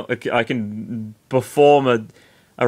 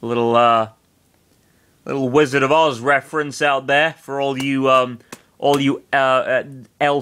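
plain reading voice

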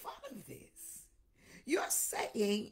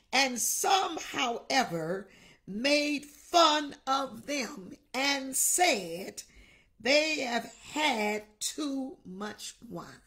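slow, weighty reading of the verse; scripture reading; 5 dB louder, much slower than usual. Real, not acted. Pastor reading Acts to the church, slow and weighty. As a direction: read the Bible verse slowly to the congregation.

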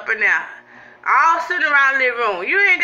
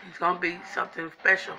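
talking while chewing; eating mid-sentence; mouth full of food; 7 semitones lower, 10 dB quieter, faster than usual. Real, not acted. Seen 0: fork brings food to mouth, then chewing through 9.3 s.

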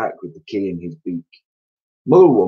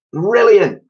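very high, loud gleeful shout; delighted cry; 13 semitones higher, 8 dB louder than usual. A narrator's reading. Mrs Twit delighted at buying guns. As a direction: shriek it with glee. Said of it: "cried Missus Twit".

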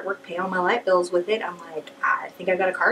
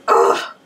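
exasperated groan; 5 dB louder than usual. Retold or imitated. Exasperated at mom.

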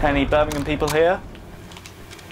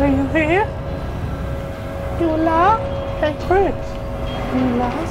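high, questioning call; high-pitched calling; 10 semitones higher than usual. Real, not acted. Player checking companion is alive, high-pitched calling.

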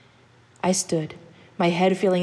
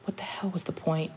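whisper; 7 dB quieter than usual. A narrator's reading. Despairing thought after crying.

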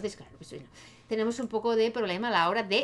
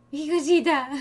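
giggling cooing, louder; laughing coo; 5 semitones higher, 6 dB louder than usual. Real, not acted. Player cooing at the baby sim, laughing. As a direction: laugh through an endearment.

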